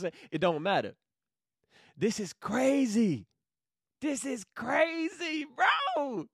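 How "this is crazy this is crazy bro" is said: excited. Real, not acted. Overcome with excitement.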